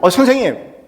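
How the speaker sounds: loud, high objection to a teacher; indignant protest. Retold or imitated. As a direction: call out like a wrongly accused pupil. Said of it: "이렇게 막 항변적으로 얘기를 했죠".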